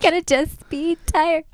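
high-pitched, drawn-out put-on tiredness; mock weary. Mom joking about teaching from the backseat, mock weary. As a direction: stretch it out high and slow, hamming up the tiredness. Mimicking herself being worn out.

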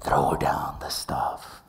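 very deep, slow hushed command; whispery, drawn-out divine voice; God voice. Acted. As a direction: rumble it slowly, low as can be, as God. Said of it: "It's my best God voice".

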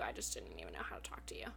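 hushed, rushed whisper; shy confession under the breath. Retold or imitated. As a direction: whisper it quickly, barely any voice. Confession voiced in retold love story.

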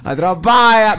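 loud, drawn-out dramatic cry; hammed-up telenovela exclamation; dramatic gasp word. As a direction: say it like a soap opera heroine caught. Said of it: "Cómo dirías atrapada".

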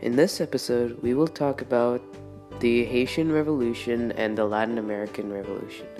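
tinny audio over music; recorded intro played back; re-recorded through speaker. Real, not acted. Intro clip over music, different source.

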